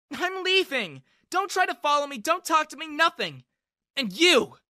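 high, loud, furious shout. A narrator's reading. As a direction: scream it as she storms off. Furious girl storms off.

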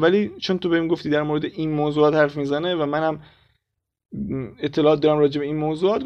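ordinary delivery

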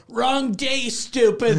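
high, loud jeer with a laugh in it; mock window shout. Joking about yelling out the window at a neighbour, mock shout.

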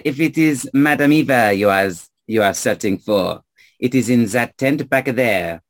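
put-on explaining in a French accent; French-accented local giving directions. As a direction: lay out the route with a French accent. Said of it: "stranger with a French accent".

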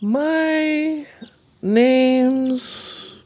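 high little sloth voice fading away at the end; slow baby sloth. Acted. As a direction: draw out each syllable with long gaps. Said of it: "You talk really slow".

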